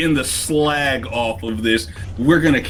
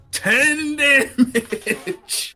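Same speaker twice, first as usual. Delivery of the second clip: long, drawn-out triumphant call; excited call; much slower than usual. Real, not acted. Player announcing the hit to the table, drawn out and triumphant. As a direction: stretch it out like a victory cry.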